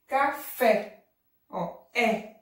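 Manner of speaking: Café with a stressed open é, then é alone. Shown as a demonstration. Teacher demonstrating the vowel, open é in Café. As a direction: sound out Café, landing on open É. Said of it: "eu falo café".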